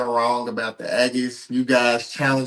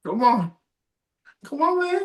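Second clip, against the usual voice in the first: high teasing whine; 14 semitones higher than usual. Real, not acted. Teasing a friend.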